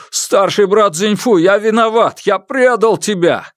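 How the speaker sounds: high, loud forced confession; father kneels and repeats the kidnapper's words, high and loud; forced loud apology. Acted.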